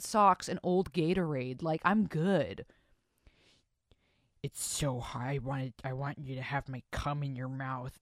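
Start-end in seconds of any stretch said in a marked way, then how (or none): 4.4-8.0 low, soft mock-sexy voice; mock a guy's dirty talk; mock sultry guy. Host mocking a guy in bed, lightly sultry.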